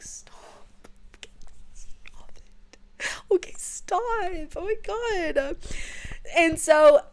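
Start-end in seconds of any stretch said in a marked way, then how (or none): 0.0-3.1 hushed, urgent pleading whisper; tense whisper. Real, not acted. Reader begging the book to stop, whispering. Reader panicking over the scene. 3.3-5.6 pitched up and drawn out, slowly; high anxious plea. Real, not acted. Reader overwhelmed by the scene, high slow plea.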